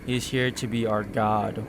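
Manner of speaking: ordinary delivery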